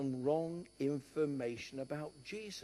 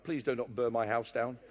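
normal talking voice

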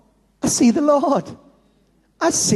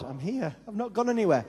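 soft, quiet God voice; God quietly answering a worried believer; gentle reassurance; 8 dB quieter, faster than usual. Retold or imitated. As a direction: reassure them softly. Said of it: "God ... says".

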